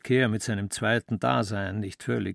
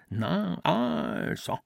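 much higher, stretched A and clipped O; mimicking father; 7 semitones higher, much slower than usual. A narrator's reading. Ambros keeps mocking his father's voice, high and exaggerated. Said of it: "Es gelang ihm so gut und täuschend".

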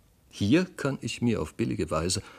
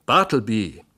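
calling the name much louder; impatient employer calls out louder; 8 dB louder than usual. A narrator's reading. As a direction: raise your voice, calling across the room. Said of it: "in noch lauterem Ton".

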